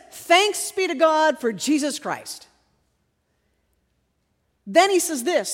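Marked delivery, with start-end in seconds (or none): 0.1-2.5 raised, emphatic praise; exultant thanks. Retold or imitated. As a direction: proclaim the thanks, stretching it out.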